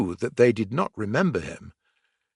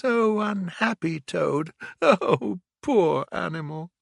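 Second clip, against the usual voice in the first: self-pitying; 7 semitones higher than usual. A narrator's reading. Toad's self-pity.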